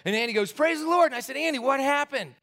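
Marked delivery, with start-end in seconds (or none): 0.5-1.1 high, loud retold rejoicing; jubilant exclamation. Pastor hearing of the healing, jubilant. 1.4-2.3 high-pitched, urgent retold question; astonished demand. Teenager questioning his pastor about healing, astonished.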